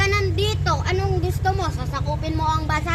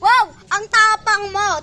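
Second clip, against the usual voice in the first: mocking; 3 semitones higher, 7 dB louder, slower than usual. Acted quarrel, mocking the other.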